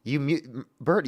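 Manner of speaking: ordinary talk, nothing marked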